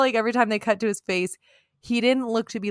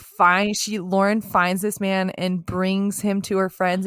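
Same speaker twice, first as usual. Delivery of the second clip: normal talking voice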